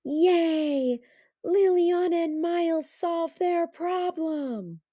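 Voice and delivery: high, happy group cheer; the class cheers for Liliana and Miles; gleeful cheer. A narrator's reading. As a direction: cheer with glee. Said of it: "The class said with glee".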